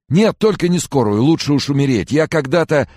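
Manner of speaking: very high, loud protest; panicked refusal. A narrator's reading. Sick man refuses an ambulance, panicked. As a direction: wail in horror at the idea.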